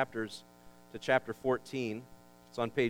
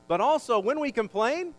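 higher, louder preaching build; preaching at full force; 8 semitones higher, 7 dB louder than usual. Real, not acted. Pastor warns congregation about complaining, preaching at full force. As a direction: push it up to the church.

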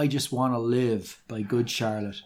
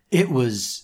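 usual speaking voice

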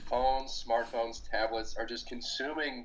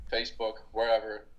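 ordinary talk

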